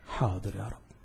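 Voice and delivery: low, very soft reply with a lift; hushed, humble assent; meek obedience. Acted. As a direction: answer submissively, under your voice. Acted meek reply to God.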